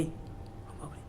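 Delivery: breathless gasp of fear; a hushed terrified gasp; whispered gasp as the stranger turns; frightened gasp. A narrator's reading. Fear reaction.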